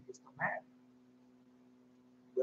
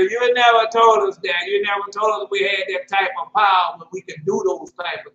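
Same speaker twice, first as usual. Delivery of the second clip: mock complaint; 8 dB louder than usual. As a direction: shout it back as an offended listener. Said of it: "howling and screaming at me".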